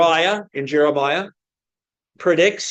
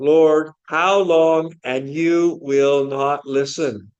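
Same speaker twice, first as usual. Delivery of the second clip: slow lament; slower than usual. Retold or imitated. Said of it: "he says".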